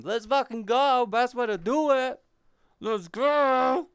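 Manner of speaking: loud, high cheering yell; hype shout. Real, not acted. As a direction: pump up the crowd. Hyping chat watching on company time.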